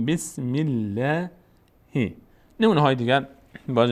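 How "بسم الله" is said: "بسم الله" slowly, thin L and fronted long "ae"; light L. Shown as a demonstration. («بسم الله» کشیده با لام باریک)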